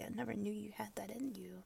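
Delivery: fast, soft teasing whisper. Acted. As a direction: rush it out in a hushed tease. Intimate whispered roleplay.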